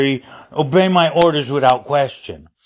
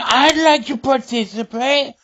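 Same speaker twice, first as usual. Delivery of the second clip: playful; 9 semitones higher than usual. Retold or imitated. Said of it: "I was, like, going".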